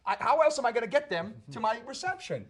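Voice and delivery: loud, high, indignant comeback; shouting back. Retold or imitated. Actor in character arguing with a cop, shouting back. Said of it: "and I shout back".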